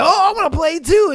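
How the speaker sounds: high, fast, loud playful pet voice; pet's voice. Retold or imitated. Dog butting in on the couple, loud high voice. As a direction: chirp it like an eager dog. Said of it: "and it'd be like".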